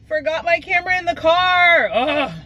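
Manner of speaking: high, whiny self-scolding; exasperated. Real, not acted. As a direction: groan it out at yourself. Repeats it, exasperated, after a laugh.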